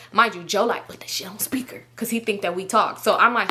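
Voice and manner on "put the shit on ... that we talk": ordinary delivery, nothing marked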